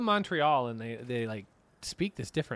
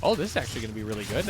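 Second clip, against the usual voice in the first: ordinary talk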